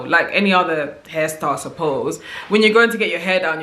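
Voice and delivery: normal talking voice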